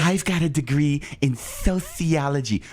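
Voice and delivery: slow, put-on lisping brag; mock lispy voice. Host answering a jab about the degree, lispy mocking voice. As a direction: stretch it out with an exaggerated lisp. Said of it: "you talk like that".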